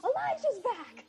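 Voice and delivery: high-pitched imitation of a sister's yell; mock long-distance yell, very high; yelling from rooms away. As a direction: call it out as if from three rooms down. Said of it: "my sister yells from three rooms down".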